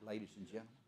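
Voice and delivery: ordinary delivery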